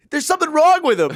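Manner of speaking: very high, loud, fast outburst; loud exasperated plea. Retold or imitated. Bystander pointing at homeless man, high exasperated shout. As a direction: cry it out, comically exasperated. Pleading while pointing at him.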